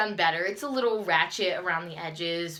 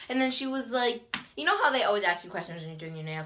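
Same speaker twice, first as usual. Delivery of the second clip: normal talking voice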